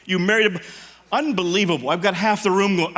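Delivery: ordinary talk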